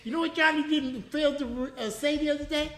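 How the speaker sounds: mock the boss in a high voice; a hint of a whiny boss, pitched high; imitating boss. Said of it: "He goes".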